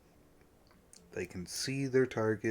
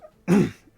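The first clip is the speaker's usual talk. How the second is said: throat clearing; 8 semitones higher, 13 dB louder than usual. Real, not acted. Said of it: "apparently I'm losing my voice".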